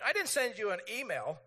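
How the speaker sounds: mock annoyance at being ignored; acted-out exasperation; irritated. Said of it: "I get a little irritated 'cause I'm like".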